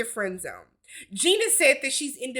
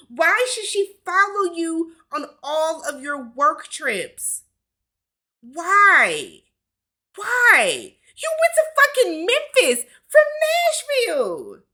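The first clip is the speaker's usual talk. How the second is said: rising, louder incredulous rant; yelling why at him in disbelief; exasperated yell; 5 semitones higher, 5 dB louder, slower than usual. Real, not acted. Ranting at Shaq about his trip, exasperated shout.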